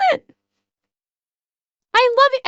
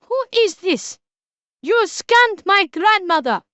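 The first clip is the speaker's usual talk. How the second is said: higher-pitched mock Russian accent; bad Russian accent; 5 semitones higher than usual. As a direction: put on a cheesy Russian accent. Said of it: "affect a bad Russian accent".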